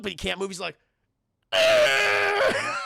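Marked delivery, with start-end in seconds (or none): 1.5-2.8 long, loud, high mock scream; terrified scream. Frozen guy who can't move, high mock scream of terror. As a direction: scream high at full volume.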